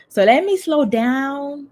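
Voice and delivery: slowing yourself down on purpose; deliberately slowed down. Real, not acted. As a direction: put the brakes on and stretch each word. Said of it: "I tend to go pretty fast".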